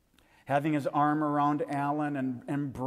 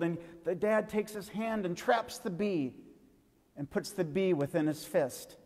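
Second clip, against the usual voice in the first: put-on lively storyteller voice, a little higher and bright for the kids; story for children; 5 semitones higher than usual. Tells children a bee story.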